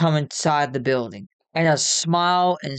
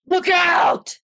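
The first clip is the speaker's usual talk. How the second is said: high, urgent shout; yelled warning; 4 dB louder than usual. A narrator's reading. Chris warns friends of a flying chair, yelled. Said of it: "Chris yelled".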